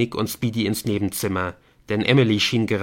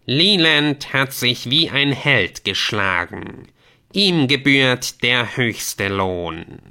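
slow, stately proclamation, slightly raised; solemn game messenger; 3 semitones higher, 5 dB louder, slower than usual. Acted. Messenger praising a fighter after battle, solemn. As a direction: proclaim it slowly and weightily.

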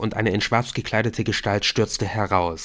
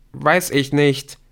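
slow, raised call back; 5 semitones higher, 5 dB louder, slower than usual. A narrator's reading. Rashid answers Nick while leaving, calling back. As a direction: throw the answer back up the stairs. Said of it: "rief Rashid zurück".

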